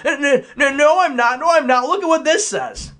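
high, panicky imitation; mock scrambling. Christians defending themselves to mockers, scrambling. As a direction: stammer it out in mock desperation. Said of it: "Christians would be scrambling".

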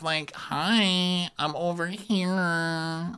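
high, drawn-out complaining voice; mock whiny fiancée. Fiancée complaining that he ignores her, mock whiny. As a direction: imitate a self-pitying whine, long on the vowels. Acting Amanda complaining about Kyle.

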